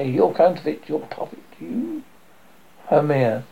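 usual speaking voice

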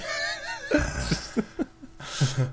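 high-pitched wheezy laughter; high wheezing laugh. Real, not acted. Laughing hard.

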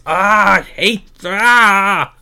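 loud, drawn-out fed-up wail; exasperated outburst. Real, not acted. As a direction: drag out a cry of sheer frustration. Exasperated rant about the booking.

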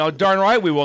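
loud, high rowdy radio host. Retold or imitated. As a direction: hype it up like a rowdy DJ. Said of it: "you had the rowdy request".

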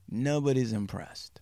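soft, dropped-down echo; quiet deadpan repeat. Real, not acted. Storyteller repeating the team's doubt, quiet and deadpan.

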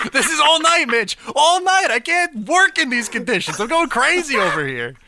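loud, high cracking-up rant; real fed-up outburst, half laughing; laughing exasperation. Real, not acted. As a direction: yell it through helpless laughter. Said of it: "This is just killing me".

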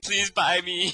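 lightly voiced little cube begging to be bought; pleading cube.

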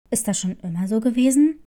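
fast low question from the nurse; low and fast school nurse. Acted. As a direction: nurse asking briskly, voice dropped.

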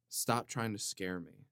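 ordinary talk

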